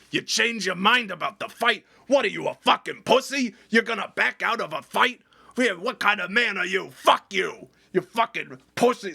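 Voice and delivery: high, loud jeering insult; mock angry taunt. Boss mocking him for backing out, loud jeering taunt. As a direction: sneer and taunt him as a coward.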